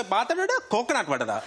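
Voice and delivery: mock Sri Lankan chatter, starting high then dropping; mum's Sri Lankan gossip. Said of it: "she'll slate me to her Sri Lankan friends".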